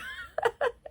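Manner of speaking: high unvoiced giggle, almost crying; breathy laugh. Real, not acted. Reacting to the demon scene, breathless giggle. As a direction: let a breathless giggle slip out.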